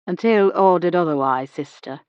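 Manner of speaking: ordinary delivery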